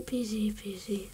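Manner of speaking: hushed whispered correction. Real, not acted. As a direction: whisper the name quickly. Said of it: "J'ai failli prononcer son vrai nom".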